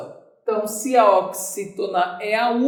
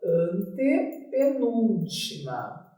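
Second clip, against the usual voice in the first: "antepenúltima" slow and softer, syllables stretched; writing-out dictation; 3 semitones lower, 5 dB quieter, slower than usual. Shown as a demonstration. Teacher noting the term for students, slow dictation. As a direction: draw out "antepenúltima" as you write it. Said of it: "A gente vem aqui e anota".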